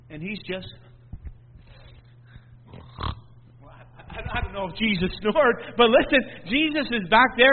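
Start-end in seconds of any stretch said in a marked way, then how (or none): none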